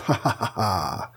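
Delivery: soft, low, spaced-out gloating laugh; mock villain laugh. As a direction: imitate a smug getaway laugh, quietly. Imitating Namor laughing while swimming off.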